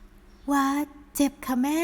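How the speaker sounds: put-on a touch of pain in a young voice; hurt daughter. Daughter tells her mother it hurts, lightly pained.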